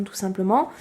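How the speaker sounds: ordinary talk, nothing marked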